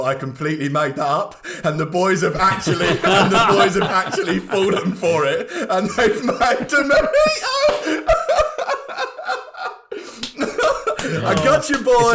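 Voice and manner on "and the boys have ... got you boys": louder, higher, slowed by laughter; gleeful laughing. Real, not acted. Host revealing his mojito prank to the boys, laughing hard. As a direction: crack up at your own prank.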